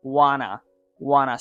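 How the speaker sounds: "wanna" with no t, falling; connected-speech wanna. Shown as a demonstration. Teacher modelling native speech, reduced "wanna". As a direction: say "wanna" as one reduced word. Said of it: "but instead they say".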